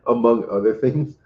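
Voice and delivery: ordinary talk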